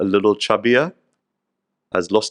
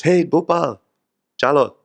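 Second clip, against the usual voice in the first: higher, louder call to Gopal; Narad Muni calling; 6 semitones higher, 4 dB louder than usual. Retold or imitated. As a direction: call him up with cheer. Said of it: "and says".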